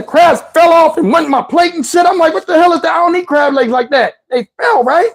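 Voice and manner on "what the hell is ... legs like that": high indignant complaint; outraged surprise. Retold or imitated. Crabs fall on his plate, high indignant. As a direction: react in disgust, pitched up.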